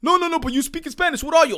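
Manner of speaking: high, loud nagging retold question; pushy questioner. Retelling a stranger pressing him, high and loud. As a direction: press him on where he's from.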